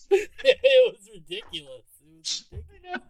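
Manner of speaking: high-pitched words breaking through laughter; real laughing. Real, not acted. Co-host recalling a hilarious scene, laughing. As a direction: repeat it while cracking up.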